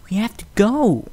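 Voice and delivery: high, pressing filly pleading to go; urgent filly voice. A narrator's reading. Filly urging friends to leave, high and pressing. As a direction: hurry the others along in a high voice.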